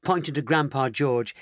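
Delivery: plain reading voice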